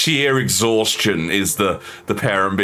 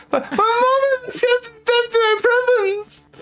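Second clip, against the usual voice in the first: put-on whiny kid.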